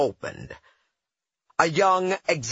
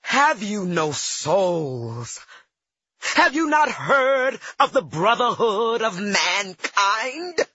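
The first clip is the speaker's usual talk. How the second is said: slow, rising, indignant scolding; berating; 8 semitones higher, slower than usual. Retold or imitated. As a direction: scold them, voice climbing. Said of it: "I berated them".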